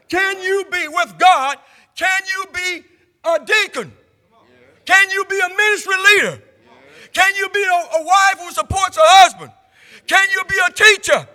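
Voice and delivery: builds to a shouted, high preacher's cry; preaching at full force. Acted. Preacher rousing the congregation, building to a shout.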